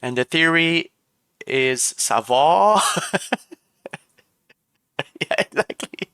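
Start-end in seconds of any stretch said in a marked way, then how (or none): 2.0-2.8 'va' drawn out as a long 'vaw'; slow, rounded 'vo' for 'va'; Quebec pronunciation. Shown as a demonstration. Streamer exaggerates for chat, 'va' as long 'vaw'. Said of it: "in Quebec".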